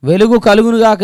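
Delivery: high, loud booming command; God commanding light, booming high; God's proclamation. Acted. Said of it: "దేవుడు ఏమన్నాడు".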